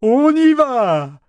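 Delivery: very high, long exultant cry; jubilant shout. A narrator's reading. Ship exulting as he starts to float, jubilant shout. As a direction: stretch it into a triumphant yell. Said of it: "s'écria-t-il en jubilant".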